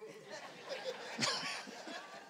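a burst of high, airy laughter; real laugh at his own tale; high breathy laugh. Real, not acted. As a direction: laugh out the story. Laughing while telling wife story.

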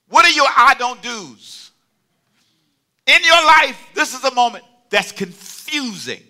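loud, high preacher's challenge; forceful challenge. Real, not acted. Preacher asking the congregation about boundaries, forceful challenge. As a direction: belt the question out to the room.

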